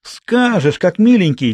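put-on high, insistent girl's voice; threatening retort. A narrator's reading. Girl threatens the boy she pinned, insistent.

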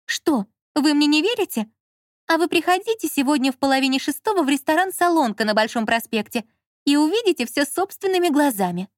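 high, heated woman's voice; Lola as wronged wife. A narrator's reading. Lola warning the director about her husband, aggrieved.

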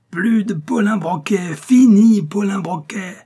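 high, loud, slow drawn-out crowing; gleeful outburst. A narrator's reading. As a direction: stretch it out in loud, high triumph. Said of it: "partit d'un grand éclat de rire". (exulter très fort, d'une voix aiguë et traînante)

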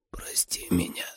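very soft, failing whisper; faded to a whisper. A narrator's reading. Dying father begging forgiveness, barely audible. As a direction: breathe out a last plea. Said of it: "Его голос становился всё тише".

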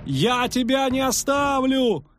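high, slow, desperate poet's voice; the poet calls out loyally to the fallen witcher; poet's cry. Acted. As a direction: cry out that you won't leave him. Poet refuses to leave friend.

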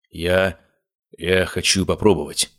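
usual speaking voice

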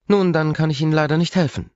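a strict receptionist nurse; stern nurse. Acted. Reception nurse questioning the visitor, strict.

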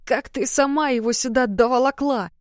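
straining under the sack; grunting with effort, lifting; carrying heavy load. A narrator's reading. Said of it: "кряхтя поинтересовалась Зоя".

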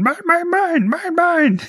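very high-pitched mock yelling; Yoda. Yoda fighting R two over a flashlight, yelling. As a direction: squeal it out like a possessive little puppet. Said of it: "And Yoda yelling".

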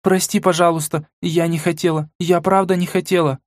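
put-on high, guilty girl pleading; sorry, sorry, like a young girl; apologetic girl. A narrator's reading. Mila apologizing, higher. (виноватый девичий голос)

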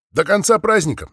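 put-on light French accent in Russian. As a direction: clown with a hint of French accent. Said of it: "ответил с французским акцентом клоун".

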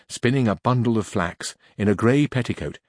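ordinary delivery

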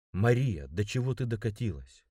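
plain reading voice

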